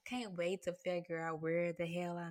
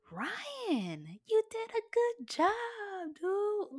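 much higher, louder, slow gushing call; excited praise; 5 dB louder, much slower than usual. Retold or imitated. Praising director Ryan for the film, high and gushing. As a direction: gush over him like you're cheering.

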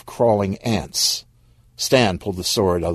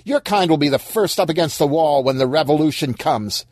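restrained acted shout, raised pitch; 8 semitones higher than usual. Corpse threatens Stan with revolution, half shouting. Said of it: "Corpse shouted".

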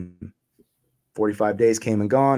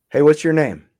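retold cop's challenge, higher and louder; cop calling out; 6 semitones higher, 6 dB louder than usual. Cop questions man in his driveway, calling out. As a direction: imitate a cop hailing someone loudly.

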